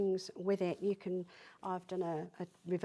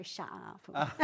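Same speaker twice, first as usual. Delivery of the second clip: put-on Essex accent; 6 semitones lower, 3 dB quieter than usual. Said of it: "She doesn't have the local accent".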